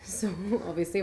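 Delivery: ordinary talk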